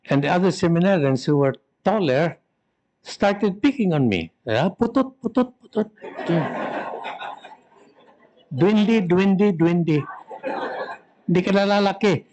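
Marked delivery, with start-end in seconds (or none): 4.7-12.2 mock taunting. Bullies taunt the small boy.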